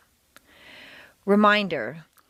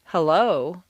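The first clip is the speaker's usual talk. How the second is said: HELLO with a questioning rise at the end; doubtful intonation. Shown as a demonstration. Teacher shows students how doubt sounds, a doubtful rising "Hello". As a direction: draw out "Hello," lifting it unsurely at the end. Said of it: "this intonation would indicate doubt".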